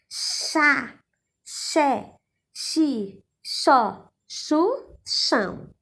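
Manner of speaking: chá, xé, xi, xó, chu, chão each said with a clear sh sound; syllable drill. Shown as a demonstration. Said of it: "Tem um som parecido com X". (sílabas chá, xé, xi, xó, chu, chão com som de X)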